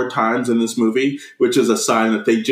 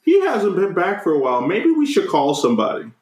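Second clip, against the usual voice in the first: higher-pitched mother imitation; mock worried mom; 6 semitones higher than usual. Mom to dad about missing son, mock worry. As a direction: lift the voice into a fretting mom. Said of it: "his mom being like".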